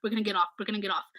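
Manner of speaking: soft, fast, anxious self-reassurance; queasy rider on the ride, hushed and hurried; nervous relief. Retold or imitated. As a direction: rattle it off quietly, hoping it's over. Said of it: "I'm like".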